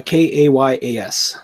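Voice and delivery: each letter said slowly and clearly, K A Y A S; slow letter-by-letter spelling; spelled out slowly. Shown as a demonstration. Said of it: "How do you spell that?".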